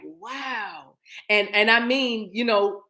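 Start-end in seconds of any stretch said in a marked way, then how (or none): 0.0-1.0 very soft, awed "wow"; hushed amazement. Retold or imitated. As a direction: let it out quietly in wonder. Retells her amazed reaction.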